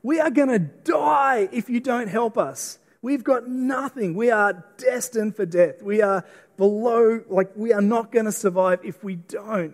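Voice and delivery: desperate wailing plea; desperate cry for help. Retold or imitated. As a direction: cry out to God as if about to die. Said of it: "they cry out".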